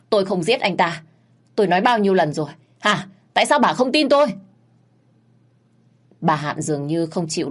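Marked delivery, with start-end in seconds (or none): 0.1-4.3 louder, rising protest; defiant retort. Acted. Frightened maid arguing back at the mother, defiant. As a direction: strain the neck and argue back. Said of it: "Gân cổ và cãi lại".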